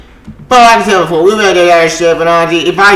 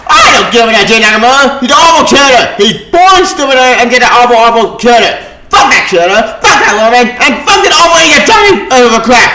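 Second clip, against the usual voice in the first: loud cursing rant; 5 semitones higher, 3 dB louder than usual. Real, not acted. Cursing out the writers.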